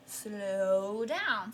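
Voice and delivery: very slow, drawn-out words; slowing right down. Shown as a demonstration. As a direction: stretch the words out to show slowing. Acts out meaning of ritardando.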